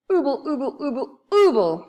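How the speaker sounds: high mumbling through stuck teeth; mouth full of toffee. Acted. Moonface answers Beth through a mouthful of toffee. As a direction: ooble it out with teeth glued shut. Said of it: "quite unable to speak properly".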